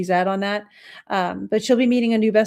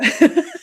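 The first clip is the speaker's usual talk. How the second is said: laugh; 9 semitones higher, 6 dB louder than usual. Real, not acted. Laughs at Baba Yaga nickname.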